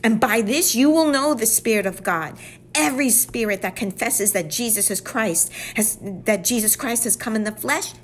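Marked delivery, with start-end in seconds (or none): none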